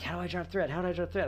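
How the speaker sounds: high-pitched panicked self-quote; mock-frantic, repeated question; freaking out. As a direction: replay your panic, pitched well up. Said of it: "I'm, like, freaking out".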